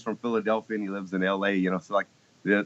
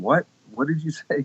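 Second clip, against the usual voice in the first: baffled questioning; 6 semitones higher than usual. Retold or imitated. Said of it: "then she was asking them".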